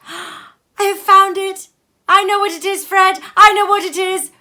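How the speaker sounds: very high, loud triumphant shriek of the plump sister; excited cry. Acted. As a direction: blurt out the answer in a burst of glee. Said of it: "cried out".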